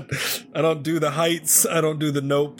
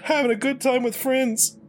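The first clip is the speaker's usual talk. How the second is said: high, strained false cheer; panicked; 6 semitones higher than usual. Real, not acted. Player insists game night is fun, panicked. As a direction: reassure yourself in a pitched-up voice. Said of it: "Nick's having a panic attack".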